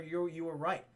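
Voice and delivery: low, hushed movie dialogue; mock-solemn quiet. Investigator conceding to the prisoner, mock-solemn and quiet. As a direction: play it soft and grave. Acting solemn confession in cell.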